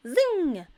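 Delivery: high, loud zing; zing like a bee; zing sound effect. A narrator's reading. Sound word in story.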